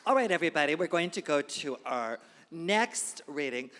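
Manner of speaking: loud emcee shout over the room applause; calling out to audience. Real, not acted. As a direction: project big to the whole crowd.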